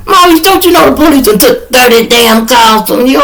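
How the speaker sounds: high, exasperated dad voice; imitating dad. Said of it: "My daddy counted them one day. And he".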